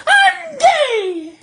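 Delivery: very high, loud squealed shout with a laugh; flamboyant shout. Retold or imitated.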